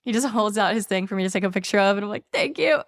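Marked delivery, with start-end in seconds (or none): 2.3-2.8 high, meek mock-tearful voice; imitated crying. Driver retelling thanking the man mid-cry, mock tearful. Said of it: "I'm just fucking crying".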